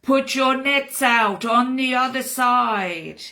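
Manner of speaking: loud, high, slow call from far off; calling from the shore. Acted. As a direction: call it across the water, unhurried. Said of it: "a voice from the shore saying".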